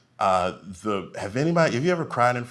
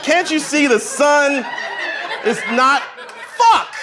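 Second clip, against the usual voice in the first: high, loud, slow laughing disbelief; mock outrage through laughter; exasperated laughing; 7 dB louder, slower than usual. Painting hung upside down.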